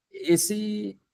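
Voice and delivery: ordinary delivery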